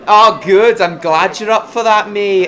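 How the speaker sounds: loud, high Lancashire cheer; hearty northern accent. Shown as a demonstration. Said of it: "trying to do a Lancashire accent".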